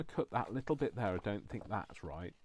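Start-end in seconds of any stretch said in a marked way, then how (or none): none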